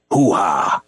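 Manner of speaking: lightly done Pacino growl; Al Pacino impression. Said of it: "I could probably do an Al Pacino".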